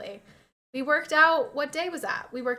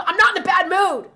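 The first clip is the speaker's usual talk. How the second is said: mock grumpy denial; 7 semitones higher, 10 dB louder than usual. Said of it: "Can you stop being in a bad mood".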